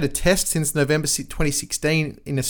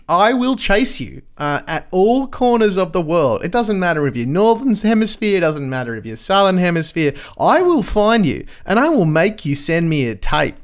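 mock menace; 5 semitones higher, 5 dB louder than usual. Mock threat to correspondents.

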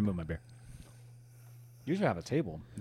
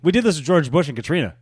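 ordinary talk

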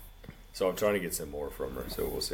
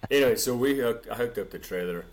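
ordinary delivery

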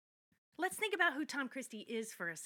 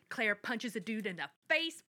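ordinary delivery

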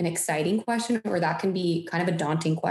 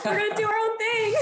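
excited. Pretend. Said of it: "I'm like".

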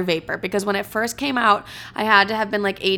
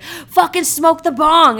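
higher, louder, slow mock yell; sister yelling; 8 semitones higher, 7 dB louder, slower than usual. Sister forcing her to smoke, mock yell. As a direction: bark it like a bossy big sister. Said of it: "And she's like".

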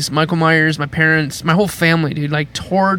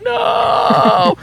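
put-on high, drawn-out childish wail; mock crying. Child refusing to approach Michael Myers, wailing in mock tears. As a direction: imitate a terrified kid's wailing refusal. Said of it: "I'd just be crying".

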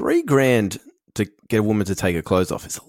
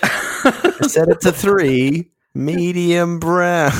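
put-on voice; 7 semitones higher, much slower than usual. A put-on voice, drawn out.

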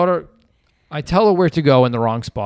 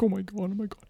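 high, tiny excited whisper; hushed excitement; 5 semitones higher, 10 dB quieter, faster than usual. Retold or imitated. Son reacting to the secret engagement, very soft high squeal-whisper. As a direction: imitate a thrilled child keeping it down.

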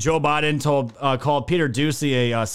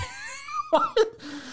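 shrill wheezing laugh fit; high squealing laugh; 6 dB quieter than usual. Real, not acted. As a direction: crack up into a squeal. Cracking up at the post.

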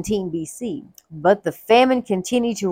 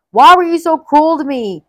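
moaning lament; 7 semitones higher, 6 dB louder than usual. Acted. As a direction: wail the reproach at your sons. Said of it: "Jacob moaned".

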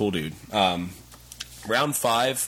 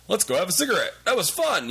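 casual friendly invite; 11 semitones higher, faster than usual. Retold or imitated. Quoting post-match friendly chat.

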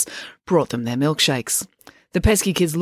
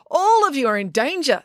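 high, dramatic proclamation; 10 semitones higher than usual. A narrator's reading. April warning the restaurant patrons, dramatic. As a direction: announce doom to the room. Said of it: "said April dramatically".

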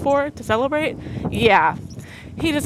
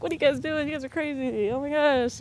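happy surprise; 6 semitones higher, 4 dB quieter than usual. Retold or imitated. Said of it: "seems happy".

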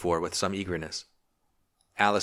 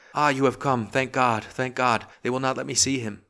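higher, louder pleading outcry; piteous cry; 5 semitones higher, 4 dB louder than usual. A narrator's reading. Distraught wife to arriving friends, crying out piteously. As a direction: cry out for pity, pitched high. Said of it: "crying out piteously".

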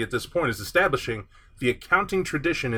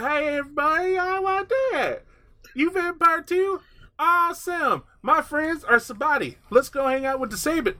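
much higher, laid-back hammed-up Southern dude, slower at the start; chill Southern drawl; 13 semitones higher than usual. Prince announcing himself to the court in a goofy high Southern voice. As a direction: play the goofy easygoing vampire prince with a Southern twang. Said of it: "talks like".

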